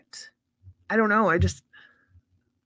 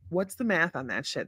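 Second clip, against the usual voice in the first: usual speaking voice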